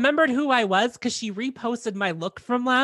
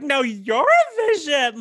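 gushing; 6 semitones higher, 5 dB louder, much slower than usual. Retold or imitated. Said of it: "I'm like".

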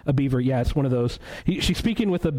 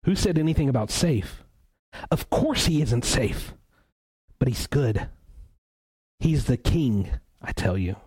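lightly voiced beaver, slowing on not safe; a hint of awe in the beaver; beaver's stern answer. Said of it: "said the beaver".

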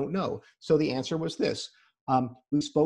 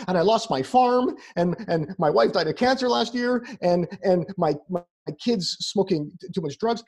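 higher, louder patient listing troubles; piling up woes, voice raised; distressed patient; 4 semitones higher, 4 dB louder than usual. Retold or imitated. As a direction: imitate a patient pouring out hardships. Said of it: "patients when they would say".

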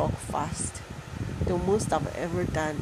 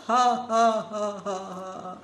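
unhinged villain laugh; 3 semitones higher, 3 dB louder, slower than usual. Pretend. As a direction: cackle like a mad villain.

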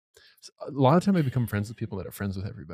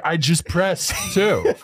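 slow, drawn-out mock exasperation, pitched up; imitated annoyed dad; 5 semitones higher, 4 dB louder, much slower than usual. Dad answering a phone tree, slow and annoyed. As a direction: push each word out at the phone tree. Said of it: "He's like".

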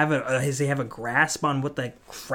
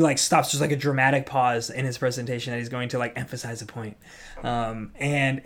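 ordinary talk

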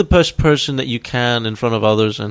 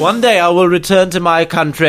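mock royal highness; 3 semitones higher than usual. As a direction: proclaim it like pompous royalty. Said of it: "fucking highness".

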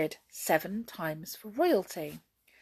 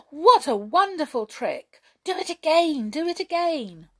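higher, loud eager cheer; excited; 10 semitones higher, 8 dB louder than usual. A narrator's reading. Fairies begging the conjurer to repeat, excited. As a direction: beg for more with delight.

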